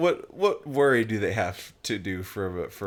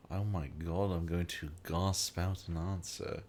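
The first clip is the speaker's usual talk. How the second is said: British accent; 3 semitones lower, 7 dB quieter than usual. Shown as a demonstration. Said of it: "you can do it British".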